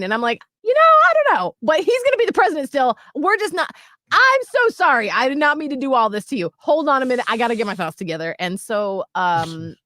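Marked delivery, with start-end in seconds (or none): 0.6-8.3 high, loud frantic babble; flustered panic. Retold or imitated. Mother retelling talk with her daughter, flustered panic. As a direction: blurt it out, spiralling into an apology.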